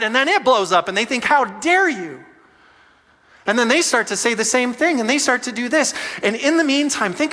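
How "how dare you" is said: higher, drawn-out indignant line; slow mock outrage. Pastor voicing the partner firing back, slow indignation. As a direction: stretch out the offended retort.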